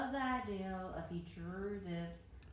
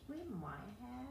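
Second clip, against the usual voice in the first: whispered, pitched-up admission; whispered reply; 6 semitones higher, slower than usual. Real, not acted. Wife whispering back to her husband, admitting.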